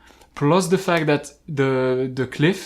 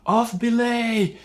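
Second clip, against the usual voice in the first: high, slow misheard call; mock call; 8 semitones higher, slower than usual. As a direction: echo the misheard command. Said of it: "you're gonna think they said".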